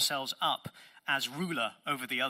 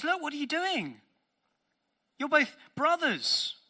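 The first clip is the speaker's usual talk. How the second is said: higher, pleading voice of Moses; 11 semitones higher, 3 dB louder than usual. Retold or imitated. Preacher voicing Moses pleading with brothers, high and pleading. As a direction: plead with the two brothers to stop.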